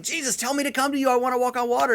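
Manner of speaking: high-pitched, quick, eager plea; eager Peter. Retold or imitated. Disciple begging Jesus to call him over, acted eagerness. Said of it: "And he's like".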